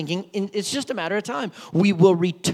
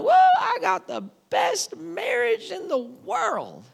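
high, slow boasting voice; mock smug boast; 12 semitones higher, slower than usual. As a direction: play a proud, self-satisfied spouse.

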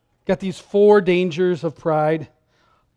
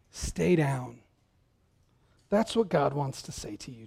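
quiet sincere; 5 semitones lower, 10 dB quieter than usual. Real, not acted. Pastor applies trainer's words gently.

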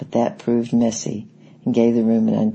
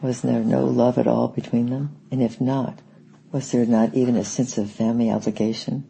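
ordinary delivery, nothing marked

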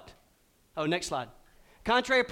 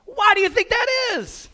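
much higher, louder question; excited call out; 8 dB louder than usual. Real, not acted. Preacher challenges the congregation, loud and high. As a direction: throw it out to the room, pitched way up.

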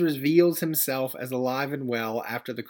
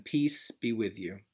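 slow, soft blessing; gentle blessing; 3 semitones lower, 4 dB quieter than usual. Retold or imitated. The risen Christ greeting his frightened disciples, slow and gentle. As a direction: offer it slowly like a gentle blessing.

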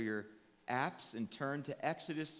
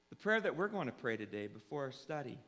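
normal talking voice

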